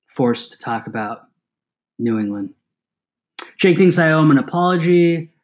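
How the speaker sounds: normal talking voice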